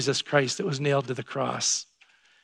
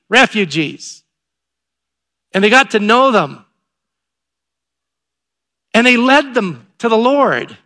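louder, emphatic storytelling from the pulpit; excited preaching; 3 semitones higher, 6 dB louder than usual. Real, not acted. Pastor telling the congregation about baptized refugees, excited preaching. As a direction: preach it out with weight on the big words.

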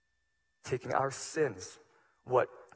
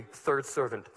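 raised, louder, higher pleading sermon voice; full-force preaching; 7 semitones higher, 6 dB louder than usual. Acted. Pastor pleading with his congregation, impassioned preaching. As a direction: preach it out with urgency to the church.

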